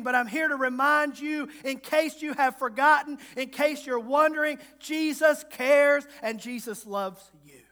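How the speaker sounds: loud, rising sermon climb; full-force preaching. Real, not acted. Pastor reminds the weary congregation that Jesus cares, preaching loud. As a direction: preach it out to the back pews.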